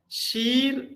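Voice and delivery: long, drawn-out vowel in "sheer", pitched high. Shown as a demonstration. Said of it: "here it's a long vowel".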